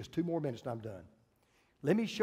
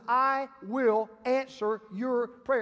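slow, emphatic divine promise; God's declaration; 6 semitones higher, 4 dB louder, much slower than usual. Acted. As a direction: declare it word by deliberate word. Preacher voices God's solemn promise.

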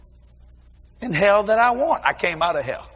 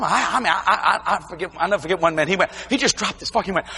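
ordinary talk

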